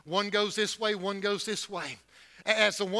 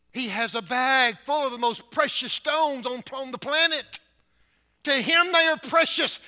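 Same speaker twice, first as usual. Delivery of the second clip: rising louder and higher; building sermon fervor; 7 semitones higher, 9 dB louder than usual. Acted. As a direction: lift the congregation. Preacher building up.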